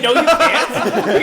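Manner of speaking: slow, loud laughing mimic; laughing imitation. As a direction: repeat his line, cracking up. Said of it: "He said".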